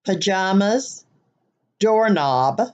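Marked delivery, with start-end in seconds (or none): none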